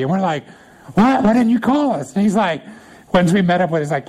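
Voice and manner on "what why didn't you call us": higher-pitched, retold indignant question; acting out a put-out complaint; mock indignant protest. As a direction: playfully protest at being left out. Retold protest to friend about party.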